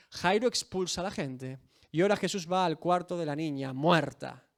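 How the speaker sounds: usual speaking voice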